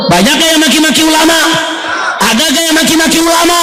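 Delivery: preacher calling out to the crowd, high; challenging the crowd. Acted. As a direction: demand an answer from the congregation.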